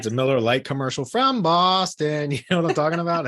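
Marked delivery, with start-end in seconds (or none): 1.1-2.3 put-on drawn-out Boston accent. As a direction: ham up a slow Boston drawl. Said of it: "that stupid Boston accent".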